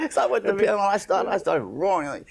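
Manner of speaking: fast, laughing through the words; words bubbling with laughter; chuckling as he tells it. Real, not acted. Laughing while telling punchline.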